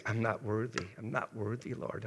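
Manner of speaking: reliving the moment he wept at the tree; retold sobbing prayer. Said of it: "and I'm sobbing".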